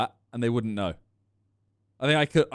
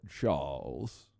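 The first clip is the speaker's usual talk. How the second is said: put-on low, quiet croaky old man; imitating old man; 12 dB quieter than usual. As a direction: mimic his creaky old man voice. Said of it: "the way he said".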